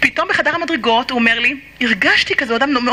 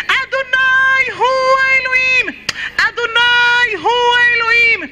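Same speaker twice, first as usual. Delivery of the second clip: fervent shout; 6 semitones higher, much slower than usual. Acted. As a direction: call it out to the heavens, over and over. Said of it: "בוא נקרא לו".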